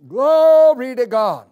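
slow, loud "Glory to God"; preacher crying out praise to the church. Real, not acted.

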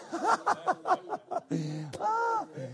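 high, soft run of laughter; high-pitched chuckling fit; real laughter. Real, not acted. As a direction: laugh at your own joke, high and light. Laughing at own joke.

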